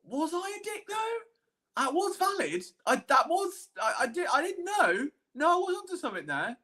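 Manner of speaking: high, rising self-doubt with a burst of protest; lying awake arguing with yourself, pitch up. Retold or imitated.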